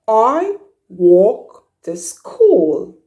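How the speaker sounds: very slow, each word laid out; slow model sentence. Shown as a demonstration. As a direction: teach "I walk to school" word by word. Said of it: "So you say".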